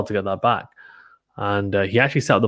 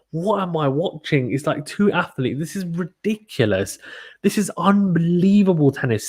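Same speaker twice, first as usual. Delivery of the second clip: can't believe what he's seeing, voice rising higher; excited disbelief; 6 semitones higher than usual. Real, not acted. Commentator losing it at a wild rally, high excited disbelief. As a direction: pitch climbing high as the disbelief builds.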